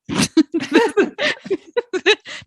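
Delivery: high, loud burst of laughter; big laugh. Real, not acted. Laughing at her own rant.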